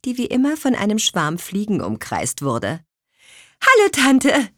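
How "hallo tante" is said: bright high smiling hello, a bit louder; smiling young witch says hello; smiling greeting. A narrator's reading. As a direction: greet your aunt with a smile. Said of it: "lächelte Petronella".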